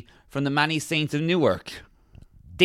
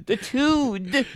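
high, drawn-out rallying call; mock Newcastle cheer; 6 semitones higher, slower than usual. Newcastle fan hails a newcomer, high mock cheering. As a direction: whoop it up like a local fan. Acted Newcastle local hailing someone.

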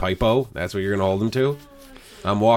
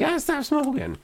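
high mock voice; squeaky put-on girl voice; high, teasing impression of the girl; imitating her. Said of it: "And she's like".

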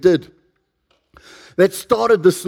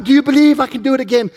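high, quick call to a crowd; showman's call; 7 semitones higher, faster than usual. Acted. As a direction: fire up the audience once more. Acted showman calling to crowd.

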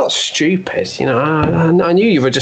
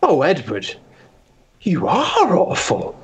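heartbroken. Acted. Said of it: "same words, now disgusted".

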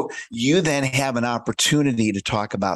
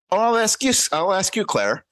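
bright opener; 9 semitones higher than usual. Shown as a demonstration. Demo opening question.

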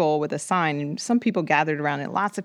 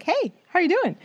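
bright friendly greeting. Retold or imitated.

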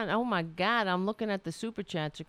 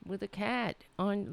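ordinary delivery

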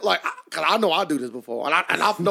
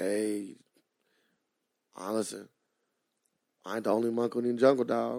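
much lower and softer, half-voiced confiding; hushed joke; 6 semitones lower, 8 dB quieter than usual. Admitting he fakes a voice for the loan, hushed. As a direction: drop the voice way down like a sly confession. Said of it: "joking confession".

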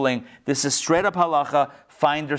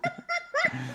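sudden high-pitched laugh burst; 9 dB quieter than usual. Real, not acted. Teacher cracking up at Alexander's answer, high laugh. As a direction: let a squeaky laugh escape.

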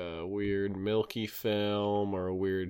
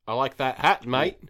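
punchy, louder Aussie catchphrase; lightly put-on Australian accent; 4 dB louder than usual. Shown as a demonstration. Showing the co-host all you need for an Australian accent. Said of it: "all the words you need for an Australian accent".

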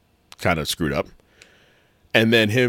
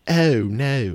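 British accent; 4 semitones higher than usual. Shown as a demonstration. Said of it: "that was very British".

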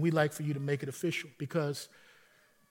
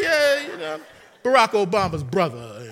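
higher and louder, laughing through the claim; mock pastor boast; 4 semitones higher, 9 dB louder than usual. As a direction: play the pastor's cheeky fib with a laugh. Imitating pastor's prank claim.

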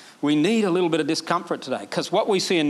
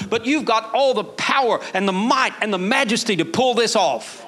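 preaching at full force; 6 semitones higher than usual. Acted. Preacher voicing Nehemiah's prayer to the church, full force. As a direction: preach it out with fire.

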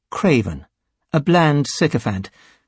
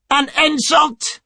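outraged, very high-pitched screech; 13 semitones higher, slower than usual. A narrator's reading. Sycophant outraged at Reith's jibe, screeching. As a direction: screech it out, drawn out and offended. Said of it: "Craven screeched".

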